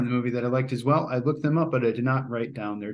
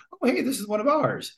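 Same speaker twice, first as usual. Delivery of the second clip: put-on old lady voice; 11 semitones higher than usual. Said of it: "The old lady picks it up and goes".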